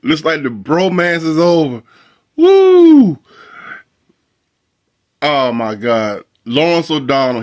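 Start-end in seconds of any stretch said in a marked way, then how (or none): 2.4-3.2 high, loud "woo"; gleeful whoop. Real, not acted. Host reacting to the bromance ending, gleeful whoop. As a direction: let out a high whoop of glee.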